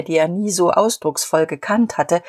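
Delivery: usual speaking voice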